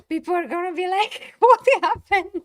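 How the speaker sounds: high, very loud laughing shriek. Real, not acted. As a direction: crack up while mocking viewers. Imagines confused viewers, laughing.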